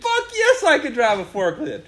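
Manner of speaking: laughing, cocky boast; cocky yes. Retold or imitated. Young hire boasting to the boss, laughing and cocky. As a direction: belt out a laughing, overconfident yes. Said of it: "And I said".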